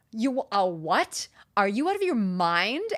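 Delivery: loud, drawn-out disbelief; incredulous. Retold or imitated. Client reacting to thirty-five thousand dollars, incredulous. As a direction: question it aghast, rising at the end. Retells shock at the price.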